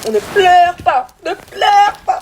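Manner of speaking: shrill-free high mock nag; mock scolding doctor. Mocking doctor scolding her.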